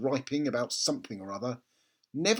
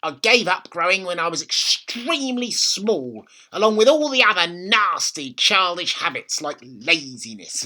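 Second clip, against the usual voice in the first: put-on higher, louder bossy old woman; bossy grandma; 3 semitones higher, 6 dB louder than usual. A narrator's reading. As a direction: order the boy about. Grandma voiced in read story.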